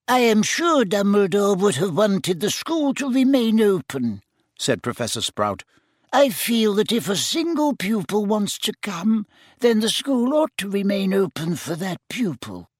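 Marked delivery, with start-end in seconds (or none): 0.1-4.2 higher, homely witch voice; Sprout's voice. A narrator's reading. 6.1-12.7 higher, homely witch voice; Sprout's voice. A narrator's reading.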